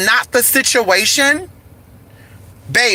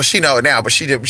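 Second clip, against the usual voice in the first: normal talking voice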